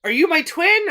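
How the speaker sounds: high, loud excited "are you my twin"; mock amazed question. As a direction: squeal it out in delighted surprise.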